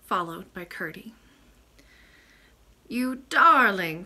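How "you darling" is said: loud, drawn-out loving exclamation; slow, higher, joyful greeting; delighted cry. A narrator's reading. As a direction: cry out fondly, stretching the words. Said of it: "cried the lady".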